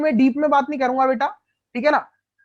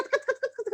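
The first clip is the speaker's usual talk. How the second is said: high, soft scratchy khach khach of a scale rubbed on hair; imitated scratching sound; 10 semitones higher, 5 dB quieter than usual. Shown as a demonstration. Teacher mimics rubbing a scale on his head, high and soft. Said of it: "कच करके रब करते थे".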